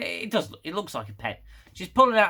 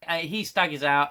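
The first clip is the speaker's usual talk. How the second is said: normal talking voice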